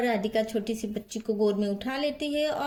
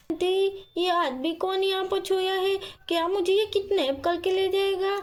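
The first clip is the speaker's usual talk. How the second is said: put-on higher-pitched child's voice; worried little girl; 8 semitones higher than usual. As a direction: ask aunty with a touch of worry. Child worried about sleeping man. (थोड़ी घबराई छोटी बच्ची)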